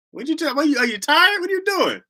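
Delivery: high, incredulous grilling; comic outrage at a sleepy caller; mock indignant scolding.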